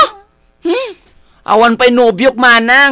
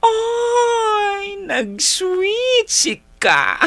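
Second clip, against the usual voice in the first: giggly whine; 11 semitones higher, much slower than usual. Acted. Girl teased about sweetness.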